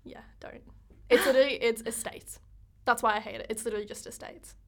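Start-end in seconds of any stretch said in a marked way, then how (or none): 0.0-0.6 breathy whispered warning; whispering. Real, not acted. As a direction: hiss it under your breath.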